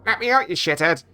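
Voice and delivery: high-pitched parrot squawk; yelling parrot. Acted. Parrot shut in the closet, yelling high at owner. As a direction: squawk angrily as a trapped parrot. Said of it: "The parrot yells through the door".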